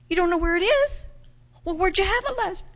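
high, disbelieving questions; alarmed questioning. Acted. Host questioning a girl about the lost retainer, high and alarmed.

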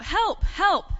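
high, loud storyteller's cry for help; calling for help. Acted. Boy at the window to his parents, calling out loudly. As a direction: call out as a boy trapped upstairs. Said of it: "So he called out".

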